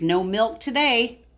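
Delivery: ordinary delivery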